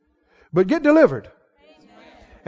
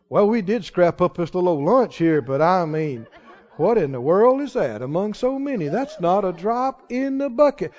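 softer, lower, deflated grumble with a rueful chuckle; mock-hopeless shrug of a reply; doubting tone; 6 semitones lower, 6 dB quieter than usual. Shown as a demonstration. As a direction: sound like nothing can help. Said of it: "as opposed to".

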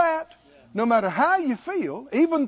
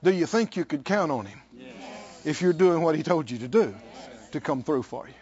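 ordinary talk

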